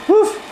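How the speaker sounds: one high, short pretend woof; dog bark imitation. Person playing the dog to tease the cat, a high bark. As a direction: give a single high-pitched bark.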